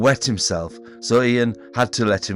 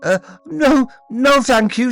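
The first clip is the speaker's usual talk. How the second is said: high, loud shaky refusal; trying not to cry; 8 dB louder than usual. A narrator's reading. David refusing again, close to tears. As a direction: hold back tears while refusing. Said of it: "trying not to cry".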